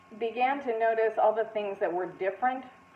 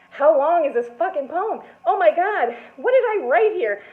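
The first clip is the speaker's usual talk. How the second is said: higher, louder, frantic thought; exasperated panic; 7 semitones higher, 5 dB louder than usual. Retold or imitated. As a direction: wonder desperately when it ends. Panicking mid poem on stage.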